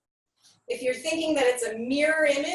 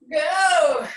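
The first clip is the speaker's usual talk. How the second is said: strained count; 3 semitones higher, 7 dB louder than usual. Real, not acted. Counting out during hard drill.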